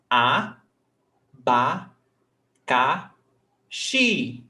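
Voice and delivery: "a, ba, ca, xi" as separate beats with pauses; syllable by syllable. Shown as a demonstration. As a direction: break "abacaxi" into four slow syllables. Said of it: "how to separate syllables".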